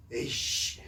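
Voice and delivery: hissed, muttered mock grumble; huffing with anger. A person in crisis fuming instead of praying, mock huff. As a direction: act out someone seething under the breath. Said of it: "막 씩씩거리면서".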